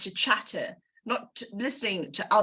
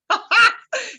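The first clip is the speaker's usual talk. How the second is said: high laugh; 12 semitones higher, 8 dB louder than usual. Real, not acted. Laughing after teasing Lucy.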